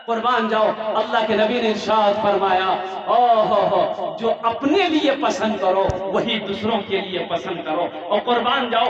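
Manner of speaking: loud rallying sermon voice; full-force preaching. Real, not acted. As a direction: exhort the congregation loudly.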